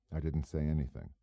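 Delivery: ordinary delivery, nothing marked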